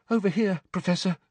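high, urgent voice; anxious schoolboy. Acted. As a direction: call a teacher over anxiously. Neville urgently fetches the professor.

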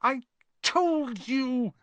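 very slow, high, drawn-out gasp; winded reproach, stretched right out. A narrator's reading. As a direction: drag it out high, short of breath. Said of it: "Hermione gasped".

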